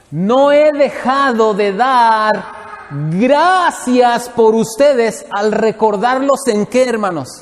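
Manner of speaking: slow, high, loud scripture reading; lectura solemne. Acted. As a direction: read Paul's letter slowly, savouring each word. Lee la carta de Pablo. (lectura lenta y solemne de la carta)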